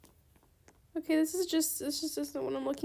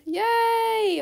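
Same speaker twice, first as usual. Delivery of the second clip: happy cheer; 7 semitones higher, 7 dB louder than usual. Real, not acted. Trade accepted.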